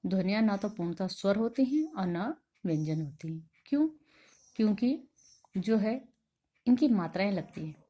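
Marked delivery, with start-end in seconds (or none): none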